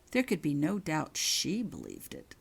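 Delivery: ordinary delivery, nothing marked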